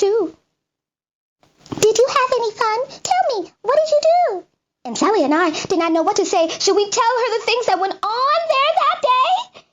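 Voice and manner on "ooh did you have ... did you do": higher, bright mother voice; mother coming home asking about fun, much higher; lightly voiced cheerful mother; mother's voice. A narrator's reading. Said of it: "she said to us".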